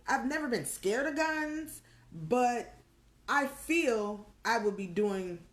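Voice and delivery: ordinary delivery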